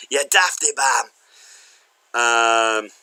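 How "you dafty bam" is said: mock-Scottish playful insult; hammed-up Scottish name-calling. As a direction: tease in a put-on broad Scottish accent. More put-on Scottish insults.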